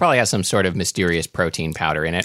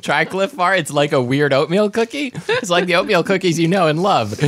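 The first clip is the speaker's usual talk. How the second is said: mock ad voice; 6 semitones higher than usual. Mock ad pitch for Cliff Bar.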